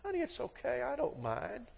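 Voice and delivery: high, soft and kind; gentle husband forgiving his wife, softly; tender reassurance. Retold or imitated. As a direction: comfort her sweetly. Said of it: "she's gonna say / reply".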